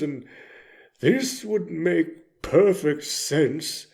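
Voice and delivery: usual speaking voice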